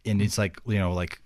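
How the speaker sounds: normal talking voice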